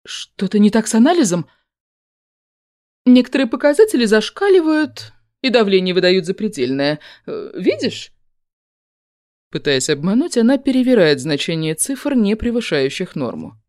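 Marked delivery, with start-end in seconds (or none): 0.1-8.1 fake alarm. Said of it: "изобразив тревогу".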